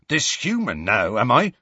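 testy, indignant retort. A narrator's reading. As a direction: answer back with mild irritation. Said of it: "said Hagrid testily".

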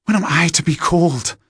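high-pitched indignant woman; loud, impatient outburst; angry. Acted. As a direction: protest hotly, pitch leaping up. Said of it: "The woman exclaimed in anger".

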